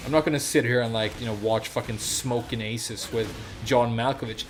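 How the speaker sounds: usual speaking voice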